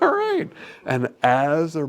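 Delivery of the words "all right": very high, louder approving "all right"; delighted. Retold or imitated. Staff cheering the calmer patient, high and delighted. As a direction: cheer the change playfully.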